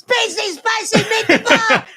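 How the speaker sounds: very high, loud comic holler; mock catchphrase shout. Joking to co-hosts about the spicy cookie smell, high mock shout. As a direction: belt it out high, drawn out at the end.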